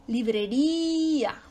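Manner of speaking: librería slow, with RÍ held long; teacher stretching the accented RÍ in librería; stress on RÍ. Shown as a demonstration. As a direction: draw out the RÍ in librería. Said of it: "también tenemos el acento ortográfico".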